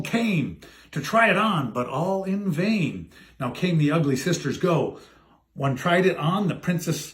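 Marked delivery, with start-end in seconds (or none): none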